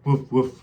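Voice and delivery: loud playful woof woof; barking dog. Shown as a demonstration. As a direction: bark it out like a puppy. Imitating dog.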